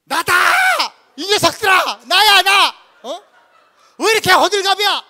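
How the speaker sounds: high, very loud bellow; shouting to be heard. Acted. Jesus calling to terrified disciples over waves, full high shout. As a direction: yell it across a storm. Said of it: "이래가지고 들리겠습니까?".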